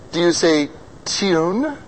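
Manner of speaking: ordinary delivery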